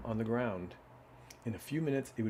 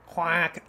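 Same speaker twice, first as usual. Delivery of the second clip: a higher, laughing quack; 6 semitones higher, 5 dB louder than usual. A narrator's reading. Duck laughing at the news, quacking. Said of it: "laughed the duck".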